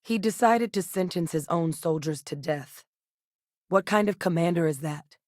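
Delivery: ordinary talk, nothing marked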